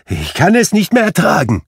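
put-on high, loud despairing old man; anguished cry. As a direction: cry out at the end of his strength. Scrooge breaking down.

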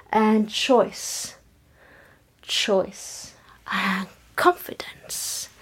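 soft whispered chant; whispering. Acted. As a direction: breathe the words out quietly.

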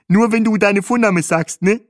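high, loud character voice; Bahe, teasing tour driver. A narrator's reading. Guide teases the latecomer.

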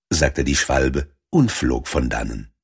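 ordinary delivery

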